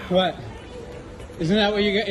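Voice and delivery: ordinary talk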